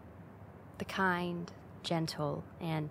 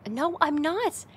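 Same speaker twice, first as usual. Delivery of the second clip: higher, louder indignant denial; playful protest; 9 semitones higher, 8 dB louder, slower than usual. Acted. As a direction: protest it brightly, up high. Denying she is lying.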